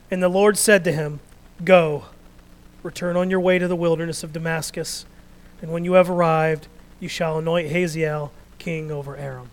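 loud, declaiming Bible reading; preacher reading the Elijah story aloud, loud and measured; scripture reading. Acted. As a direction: proclaim the passage to the congregation.

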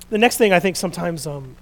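usual speaking voice

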